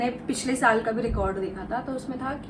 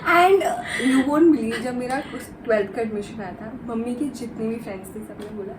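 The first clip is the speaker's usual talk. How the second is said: ordinary delivery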